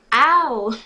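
loud, high, drawn-out yelp; pained "ow". A narrator's reading. Girl hit on the head by a basketball, pained yelp. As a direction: yelp "ow" loudly as if struck.

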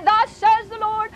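slow, high prophetic intoning; mock prophecy. Someone prophesying over the woman, slow high intoning.

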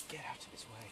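breathy unvoiced whisper. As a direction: whisper so the bear won't hear. Sneaking past the bear.

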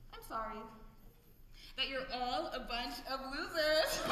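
normal talking voice

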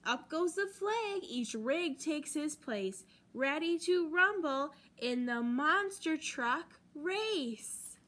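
ordinary delivery, nothing marked

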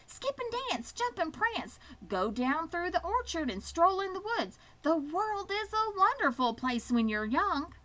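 a giddy goose cheering the pig on; excited goose. Acted. Goose urges newly free Wilbur to roam, high excited chatter.

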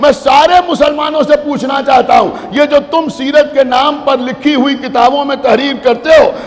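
preacher rousing the crowd to force; fiery preaching. Acted. Majlis speech at full force.

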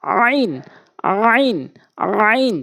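আইন squeezed hard from mid-throat, pitch swooping up then down; pressed ayn. Shown as a demonstration. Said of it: "চাপ দিয়ে উচ্চারণ করবেন".

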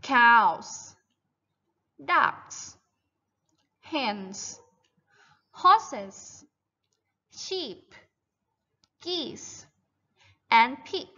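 one word at a time, long pauses; measured dictation-like list for pupils to echo; model word list. Shown as a demonstration. Teacher lists farm animals for children to repeat, model word list.

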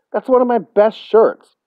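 put-on high, loud boyish protest; indignant. A narrator's reading. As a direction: protest loudly over a ruined favourite. Boy objects to torn shirt.

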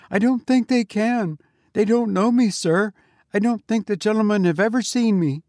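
high, nervous babbling; frightened. A narrator's reading. Frightened sexton.

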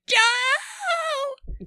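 high, strained scream of a name; mock high-pitched fan shriek; imitated fan scream. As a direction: scream his name like a starstruck fan in the crowd. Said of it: "And you'll hear me, like".

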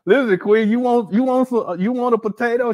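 mock Umar offering. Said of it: "He said".

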